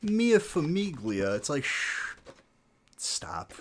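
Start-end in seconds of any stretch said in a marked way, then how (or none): 0.0-1.4 higher, louder, slow hammed-up Italian accent; mock Italian. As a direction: stretch it out like a cartoon Italian. Mocking fake Italians.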